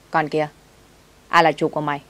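ordinary delivery, nothing marked

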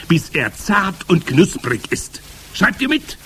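high, loud witch voice; scolding Grand High Witch. A narrator's reading. Witch leader ordering her followers to pay attention, bossy. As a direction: lecture them bossily, pitched high.

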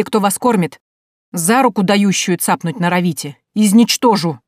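loud, ranting threats from a furious uncle; acted raging tirade; angry threats. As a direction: threaten everyone at the top of your temper. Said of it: "Ишь, как грозится".

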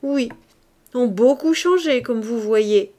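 a loud chanted echo of the words "ont beaucoup changé"; chimed in chorus. A narrator's reading. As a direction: ring it out together like a chorus of bells. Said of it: "carillonna en chœur toute la société".

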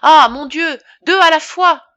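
panicked doctor wailing in dismay; distraught outcry. A narrator's reading. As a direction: cry out, overwhelmed by two fainting men. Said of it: "dans son émotion".

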